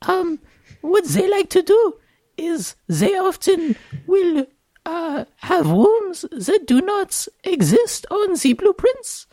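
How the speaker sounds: put-on high, squeaky little kid voice; a small child explaining seriously; preschooler. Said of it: "you have to use your little kid voice".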